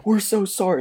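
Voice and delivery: high, hurried mock-scared sorry; panicked apology. Teens apologizing to armed drunk, mock panic. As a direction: squeak it out before running. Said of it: "we're like".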